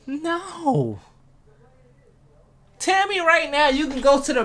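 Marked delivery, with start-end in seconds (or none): none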